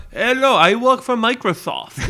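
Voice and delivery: put-on Indian caller accent; mock Indian accent. Imitating a scam caller, mock Indian accent. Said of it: "I got an Indian phone call".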